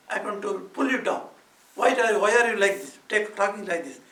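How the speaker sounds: loud, indignant wife telling off a nephew; acted-out bold confrontation; bold scolding. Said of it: "she could have even boldly said".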